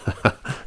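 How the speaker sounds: quiet, breathy real chuckle; soft chuckle. Real, not acted. Laughing at own stupid jokes, quiet chuckle. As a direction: chuckle softly at your own joke. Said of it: "I'm laughing at my own stupid jokes".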